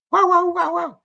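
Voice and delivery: very high yelping barks; imitating another dog. A third dog joining in far away, high yelping barks. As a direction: yelp like a little distant dog. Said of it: "another dog in the distance would go".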